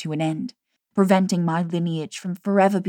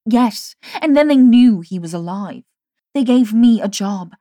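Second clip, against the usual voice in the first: furious; 3 semitones higher, 7 dB louder than usual. A narrator's reading. Jamie's furious tirade.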